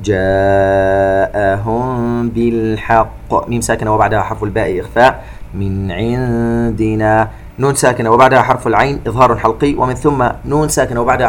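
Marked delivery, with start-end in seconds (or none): 0.0-3.5 slow chanted tajweed recitation with echoing qāf; Quran recitation. Shown as a demonstration. 5.5-7.3 slow chanted tajweed recitation with echoing qāf; Quran recitation. Shown as a demonstration.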